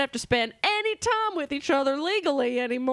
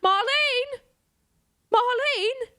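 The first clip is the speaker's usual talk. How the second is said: loud high call across the room; calling loudly for Marlene; 12 semitones higher, 3 dB louder, slower than usual. Acted. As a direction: shout her name to get her attention. Trevor calling out from afar.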